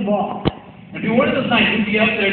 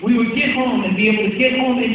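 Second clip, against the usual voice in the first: usual speaking voice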